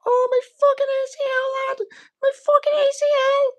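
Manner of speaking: very high-pitched mock cry of alarm; Darren Till reaction. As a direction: squeal it like a hammed-up injured fighter. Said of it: "Till reaction".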